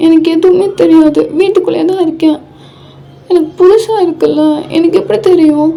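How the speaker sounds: high, wailing wife's complaint; tearful outburst. Acted. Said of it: "அழுகாத". (அழுகையுடன் உயர்ந்த குரலில் புலம்பல்)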